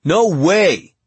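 high, loud burst of amazement; astonished exclamation at a juicy secret; excited disbelief. A narrator's reading. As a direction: blurt it out, far too loud.

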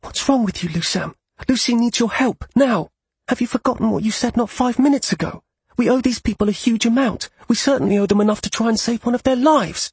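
high, furious near-scream; angry, almost screaming. Acted. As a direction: scream at him in anger. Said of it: "she almost screamed at him".

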